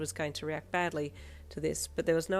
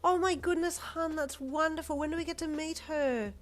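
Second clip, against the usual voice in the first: much higher, thrilled gush; excited gushing; 12 semitones higher, 4 dB louder than usual. Acted. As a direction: gush over the good news. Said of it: "Jiro's mum gushed excitedly".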